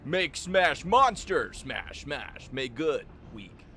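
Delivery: slow, loud dim-witted brute. Pretend. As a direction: bellow it like a caveman brute. Brute creature voice in game.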